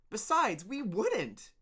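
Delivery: high, loud cry; cried out. Acted. Another worker crying out to the leader, high. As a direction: cry out in protest. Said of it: "cried someone else".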